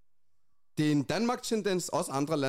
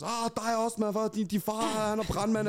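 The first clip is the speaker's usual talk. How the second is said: higher-pitched imitation of teasing youths; mock taunting; 7 semitones higher than usual. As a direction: throw out a mock diss in a raised pitch. Imitates teenagers trading insults. (hånende, drillende efterligning)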